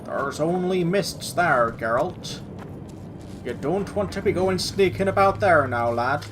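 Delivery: lilting, vaguely rural put-on accent; made-up regional accent. Player playfully warns Geralt off the mists, improvised accent. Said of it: "I don't know what ac-, accent I'm doing".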